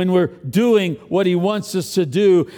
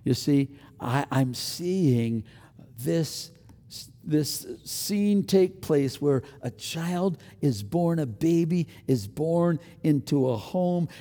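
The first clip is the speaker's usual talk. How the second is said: ordinary delivery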